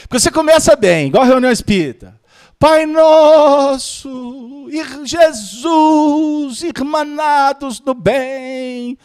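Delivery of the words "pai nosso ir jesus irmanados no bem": drawn-out, high-pitched pious chanting; exaggerated, very slow prayer voice; mock prayer intonation. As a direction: stretch each vowel like a solemn meeting opener. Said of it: "Aí muda a entonação da voz".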